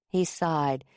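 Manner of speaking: ordinary delivery, nothing marked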